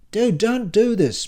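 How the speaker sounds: high, loud, drawn-out plea; pleading. Acted. Silvio begs Tsar to stop being rude, pleading high. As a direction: plead with a friend, pitched right up. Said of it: "pleads Silvio".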